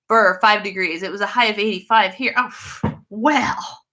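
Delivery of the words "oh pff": normal talking voice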